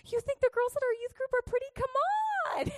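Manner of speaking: high, quick teasing pitch; hammed-up enticing come-on; playful coaxing. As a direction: sell it in a high, cajoling voice. Mock enticing teenage boys.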